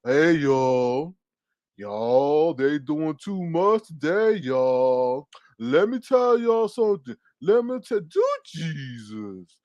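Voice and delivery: very slow, drawn-out drawl; mock slow talker. Host mimics Jay Wilson talking slow as hell, exaggerated slow drawl. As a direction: stretch every word out to mimic a slow talker. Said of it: "talking slow as hell".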